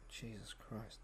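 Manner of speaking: very soft exasperated mutter. Real, not acted. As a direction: mumble it under your breath. Muttered after interruption.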